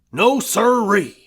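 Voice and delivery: loud, falling No sirree; gruff denial. A narrator's reading. Husband dismissing the doctor, loud and gruff. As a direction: shut it down flat and firm.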